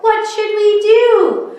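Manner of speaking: high, loud alarmed cry; children crying out. Acted. Children asking what to do, crying out high. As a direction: call out in a panic. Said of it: "The children cried out".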